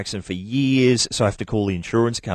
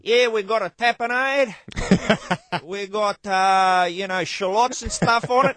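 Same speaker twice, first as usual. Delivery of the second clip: higher, hammed-up pizza guy voice; gourmet pizza character; 12 semitones higher, slower than usual. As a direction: sell the toppings like a cheesy pizza man. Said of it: "the gourmet pizza character guy".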